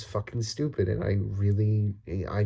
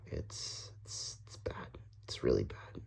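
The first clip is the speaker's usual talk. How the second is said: breathy unvoiced whisper, much softer; 12 semitones lower, 13 dB quieter than usual. Real, not acted. Vlogger admitting the country is bad, in a whisper. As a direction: drop to a whisper as if confessing.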